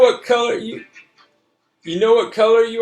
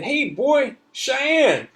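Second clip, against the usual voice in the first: high call to a dog, only a bit louder; hollering to a dog; 14 semitones higher, 4 dB louder, much slower than usual. Acted. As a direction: call the dog across the street. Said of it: "I holler at the dog".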